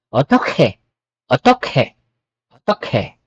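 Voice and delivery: otokhe, push air out on the kh; aspirated k. Shown as a demonstration. Teacher showing the aspirated K. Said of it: "mararamdaman mo yung hangin".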